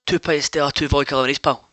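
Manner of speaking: lightly voiced Glaswegian order; a hint of a Glasgow punter ordering; Glaswegian accent. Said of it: "guy from Glasgow".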